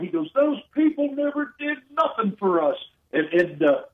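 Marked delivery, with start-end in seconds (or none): 0.4-2.8 high mock holler of an angry father; retold holler. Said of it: "then he hollered".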